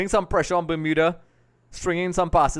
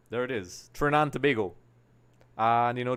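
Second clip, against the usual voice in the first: ordinary delivery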